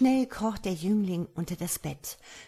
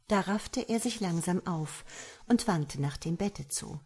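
usual speaking voice

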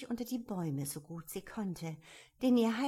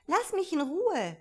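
indignant; 9 semitones higher, 7 dB louder than usual. A narrator's reading. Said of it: "sagte Alice mit Entrüstung".

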